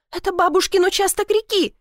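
high-pitched insistence with falling end; indignant cry. A narrator's reading. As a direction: insist hotly, pitched well up. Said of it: "крикнула она". (возмущённо настаивая)

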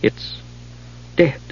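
soft murmur. Acted. Palin murmuring to himself.

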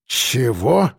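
drawn-out doubtful question; distrustful drawl. A narrator's reading. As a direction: stretch it out in disbelief. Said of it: "недоверчиво протянул кирпич".

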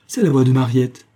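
sudden concern, understated; slightly shaken. A narrator's reading. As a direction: react with a touch of alarm. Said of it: "en se redressant un peu émue".

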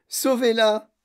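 loud, high cry for help; desperate plea. A narrator's reading. Mother pleading for her daughter, desperate cry. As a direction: beg loudly, voice pushed up. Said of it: "pleura madame Beauregard".

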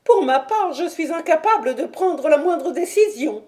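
high, loud, anxious helpless voice; a panicked man admitting he cannot act; helpless frightened man. Acted. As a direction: sound too scared to decide anything. Frightened Thaddeus still speaking.

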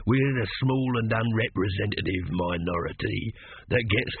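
unhurried mocking robber; menacing thief. Leading thief's voice.

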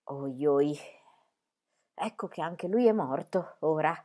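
low, soft mock lament. A narrator's reading. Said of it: "si lamentò".

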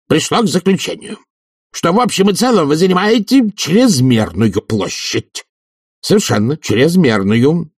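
high-pitched, insistent official; officious committee head. Acted. Committee head accuses professor of excess rooms, high and insistent. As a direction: press the accusation in a high, emphatic voice.

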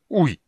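high, loud "ooy" of disgust; sudden squeamish exclamation; disgusted yelp. A narrator's reading. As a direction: cry out in revulsion. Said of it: "брезгливо вскрикнул Карамон".

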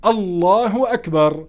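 الله أكبر with a clear ضمة, alahu akber; correct takbir. Shown as a demonstration. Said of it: "شو اللي بيصح".

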